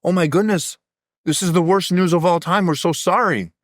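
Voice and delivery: high, gushing mock sympathy; hammed-up villagers consoling the farmer; sympathetic villagers. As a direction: fuss over the bad news. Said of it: "the townsfolk come and go".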